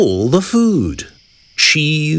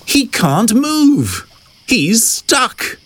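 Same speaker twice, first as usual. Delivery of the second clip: higher-pitched dismay; storybook alarm; 7 semitones higher, slower than usual. A narrator's reading. Narrator tells children hippo is stuck, high alarmed telling. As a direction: narrate it with raised, worried pitch.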